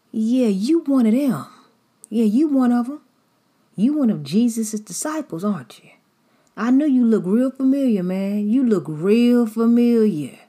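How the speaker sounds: high, loud, pointing him out in the crowd; loud accusing call. Acted. Bystander accusing Peter of following Jesus, loud and high. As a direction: call it out like you caught him. Someone else saw him and said.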